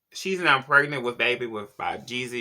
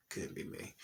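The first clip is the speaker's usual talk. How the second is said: whispered aside; 19 dB quieter than usual. Real, not acted. Dismissive aside after gossip.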